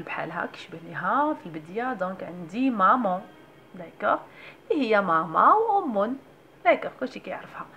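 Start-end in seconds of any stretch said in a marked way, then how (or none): none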